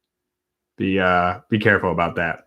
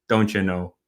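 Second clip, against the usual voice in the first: Wisconsin accent; 2 semitones lower, 3 dB quieter than usual. Shown as a demonstration. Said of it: "Milwaukee accents are".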